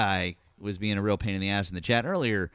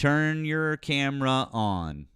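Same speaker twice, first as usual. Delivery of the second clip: every word stretched out slowly; drawn-out demand; 3 semitones higher, slower than usual. Real, not acted. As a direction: insist by dragging out each word. Same demand drawn out over caller.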